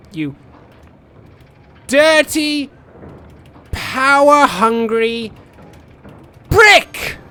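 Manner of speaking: high, loud yell in separate bursts; mock yelling. Player insults his coal plant, yelled in bursts. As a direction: yell each piece, biggest on the last word. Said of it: "Because you yelled it".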